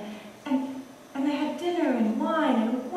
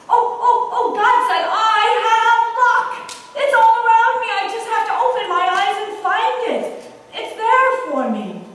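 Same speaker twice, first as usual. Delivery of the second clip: loud, much higher, sing-song joy; ecstatic gushing; 9 semitones higher, 9 dB louder than usual. Acted. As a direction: gush it out, nearly singing. Man gushes to woman.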